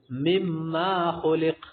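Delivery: very slow chant with a doubled m in مما; wrong recitation. Shown as a demonstration. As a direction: stretch مما خلق, pressing the double m. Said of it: "تو معنی سے ہوتا ہے".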